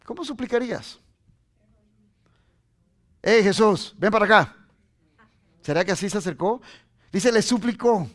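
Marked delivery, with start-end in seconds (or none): 3.2-4.6 loud, offhand summons, nothing humble; mock bossy shout at Jesus; demanding call. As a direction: call him over like a servant, louder. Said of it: "¿Cómo suplicas?".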